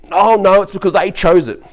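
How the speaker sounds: loud, high parody of an arguer; hammed-up dismissive comeback; mock objection.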